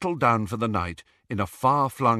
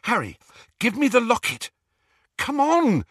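high, urgent impatience; impatient; 13 semitones higher, 4 dB louder than usual. A narrator's reading. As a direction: hurry him up impatiently. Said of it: "she said impatiently".

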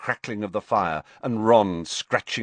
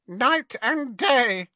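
higher, slow elf croak; much slower than usual. A narrator's reading. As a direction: croak it out slowly like an old elf. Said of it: "croaked Creature".

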